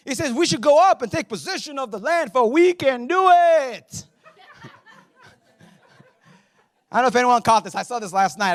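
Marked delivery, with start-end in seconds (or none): 0.3-4.1 rousing urge. Retold or imitated. Caleb urges taking the land.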